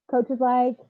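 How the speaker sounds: ordinary talk, nothing marked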